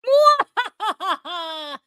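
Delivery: drawn-out mwahaha villain cackle; villain laugh. Acted. Capping a mock threat with an evil laugh.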